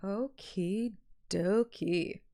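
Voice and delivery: usual speaking voice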